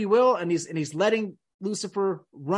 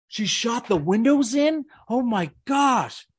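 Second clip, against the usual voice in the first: slow, higher, hammed-up amazement; mock shocked gasp; 4 semitones higher, slower than usual. Speaker mocking gullible viewers reacting to the footage, hammed-up shock. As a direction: play the gullible viewer gasping.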